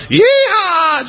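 a big happy shout at your desk; gleeful yeehaw. Pretend. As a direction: whoop it out like you're jumping for joy. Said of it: "click your heels and go".

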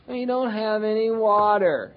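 slow, drawn-out complaining of thirsty wanderers; whining Israelites. Retold or imitated. Preacher voicing the Israelites whining about no water. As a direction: moan it like a thirsty crowd. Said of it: "And they start whining".

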